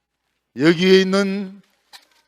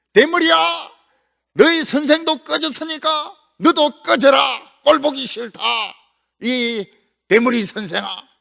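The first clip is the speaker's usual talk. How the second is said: high, loud jeering with a sneering laugh; mocking thugs; 7 semitones higher, 6 dB louder, slower than usual. Jericho thugs jeering at bald Elisha, loud mocking taunts. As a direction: taunt him like a gang of bullies. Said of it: "엘리사를 막 놀리는 거예요".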